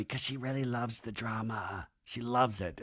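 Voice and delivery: soft, low confidential undertone; imitated whisper. Nia to Doty about Janet loving drama, half-whispered. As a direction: drop it down like a sly aside. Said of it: "like, whispers to Doty".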